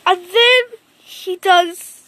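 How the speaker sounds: ordinary delivery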